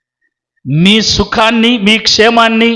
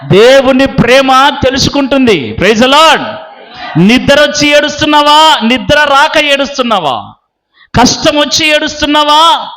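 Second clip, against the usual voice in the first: raised, insistent sermon voice; impassioned preaching; 7 semitones higher than usual. Acted. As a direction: build a driving list for the congregation. (ఆవేశంగా ప్రసంగించే స్వరం)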